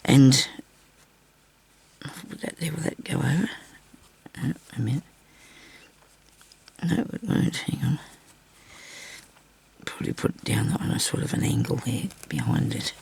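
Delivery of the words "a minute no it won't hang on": ordinary talk, nothing marked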